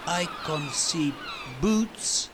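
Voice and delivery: put-on a foreign boy sounding out English; Carlos thinking.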